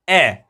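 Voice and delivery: "ए" as open ae, dropping in pitch; short A sound. Shown as a demonstration. Teacher repeats the short sound, open ae on "ए". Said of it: "short sound में ए पढ़ते हैं".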